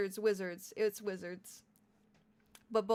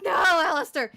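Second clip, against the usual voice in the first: laughing protest; 8 semitones higher, 15 dB louder than usual. Real, not acted.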